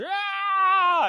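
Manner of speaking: very high, loud mock scream; imitated child's scream. Dad imitating hurt daughter screaming in the room, high scream. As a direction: let out a child's piercing shriek, in imitation. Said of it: "and she screams".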